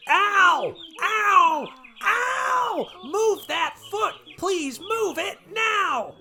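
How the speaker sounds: very high, loud cries of pain, slowing on the plea; pained shouting. Acted. President begging Thomas to lift his foot, very high pained shouts. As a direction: yowl and beg him to move.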